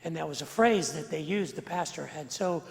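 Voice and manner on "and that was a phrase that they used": usual speaking voice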